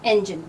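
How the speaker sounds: right "इंजन", short e first; correct engine. Shown as a demonstration. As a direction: repeat "इंजन" low, opening with eh. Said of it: "ये इंजन होता है".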